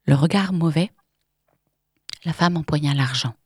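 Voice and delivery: plain reading voice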